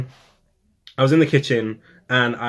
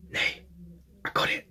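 soft, near-whispered news; whispering a secret win to a friend; hushed; 8 semitones higher, 8 dB quieter than usual. Retold or imitated. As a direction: breathe the news to her. Telling friend the news quietly.

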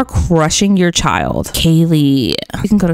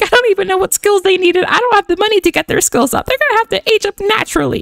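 high-pitched, squeaky rush; mock-frantic high whine; panicked fretting; 13 semitones higher, faster than usual. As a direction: fret in a high, strained voice. Player voices own fretting.

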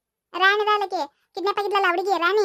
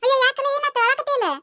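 higher-pitched startled questioning; taken aback, pitch raised; concerned surprise; 5 semitones higher than usual. Acted. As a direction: react to finding your friend in tears. Friend finds the boy crying.

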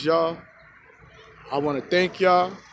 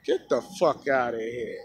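lower, dismissive mock front-office voice; Timberwolves dismissing; 6 semitones lower, 4 dB quieter than usual. Timberwolves brush off Butler's demand, low and dismissive. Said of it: "what the Timberwolves gonna say".

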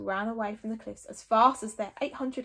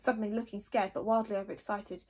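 usual speaking voice

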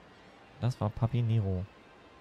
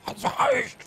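cute anime streamer. Pretend. High squeak.

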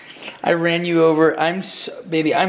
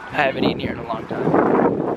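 ordinary talk

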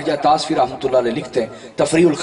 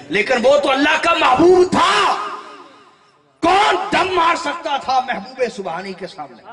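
fervent preaching; 10 semitones higher than usual. Acted. Preacher rousing the congregation about Ghaus Pak. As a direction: build the fire in the sermon.

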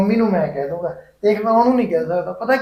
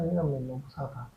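much softer, under his breath; muttered aside; 4 semitones lower, 18 dB quieter than usual. Acted. Husband mutters a complaint about his wife, softly under his breath. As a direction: grumble it so she can't hear. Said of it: "ਕੁਛ ਕਿਹਾ?".

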